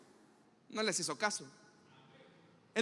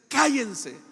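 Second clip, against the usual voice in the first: sharp, louder stern order; stern command; 6 dB louder than usual. Acted. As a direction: silence the crowd in a different voice. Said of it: "Jesús dice". (Jesús manda callar a la gente, severo)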